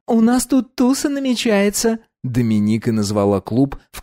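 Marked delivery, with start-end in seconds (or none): 0.1-2.0 put-on bright high young woman; high girl voice. Dominika voiced.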